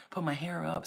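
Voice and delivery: ordinary talk, nothing marked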